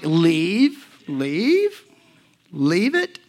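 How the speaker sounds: loud words swooping up at the end; imitated prompting with a rising lilt; insistent urging. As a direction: nudge insistently, each word rising. Voices God's nudge to leave.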